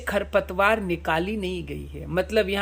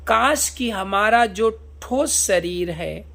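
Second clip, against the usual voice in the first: ordinary talk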